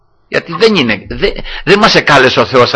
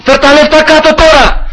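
re-enacted yell; 14 semitones higher than usual. Creditor calling up from below his debtor's house, re-enacted yell. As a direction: imitate yourself yelling to someone upstairs. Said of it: "άρχισα να φωνάζω".

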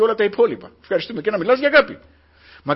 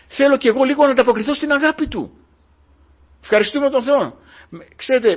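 louder, swelling sermon delivery; fervent preaching; 3 semitones higher, 6 dB louder than usual. Acted. Preacher proclaiming God's love to the church, louder. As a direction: lift the congregation with God's love.

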